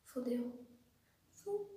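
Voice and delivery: barely voiced, very soft; whispered dread. Daughter after father's summons, whispered doom. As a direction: breathe it out in doom.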